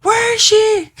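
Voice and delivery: high-pitched acted cry; hammed-up frantic question; frantic demand. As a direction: call out urgently, hunting for her. Man demands to see her.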